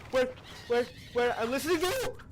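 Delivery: loud, high, rising shout; high-pitched outburst at an enemy; frantic yell. Real, not acted. Yelling at game enemy.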